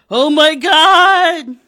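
loud, slow panicked wail; terrified cry. Acted. Pratt seeing roaches swarm over him, terrified. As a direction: cry out in horror.

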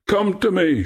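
high, ringing call; echoing shout. A narrator's reading. Nymph shouting his last words back, high echoing call. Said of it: "shouted Echo".

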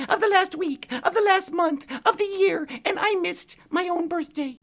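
very high, loud, slow fairy voice; tiny pixie voice. Acted. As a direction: lament like a tiny crying pixie. Pixie figure held voice.

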